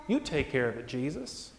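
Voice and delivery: low, soft handing-over; quiet trust. Acted. Disciple leaving the problem to Jesus, quiet trust. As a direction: say it gently, trailing down to almost nothing.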